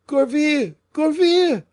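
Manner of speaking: very high, loud calling out; frantic cry. A narrator's reading. Fouquet calling his servant Gourville, frantic loud cry. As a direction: call the name in panic. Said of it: "whilst Fouquet repeated".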